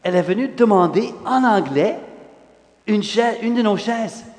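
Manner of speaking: ordinary delivery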